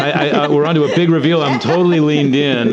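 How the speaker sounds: laughing. Real, not acted. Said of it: "laughing banter about the reveal".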